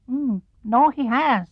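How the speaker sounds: a tiny piglet nodding along. Acted. Piglet agrees with Pooh, squeaky.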